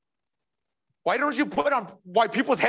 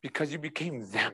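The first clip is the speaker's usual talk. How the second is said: much softer and lower; hushed grave answer; 7 semitones lower, 10 dB quieter than usual. Acted. Preacher voices God answering Jesus on the cross, hushed. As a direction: let it drop to a quiet, sombre reply.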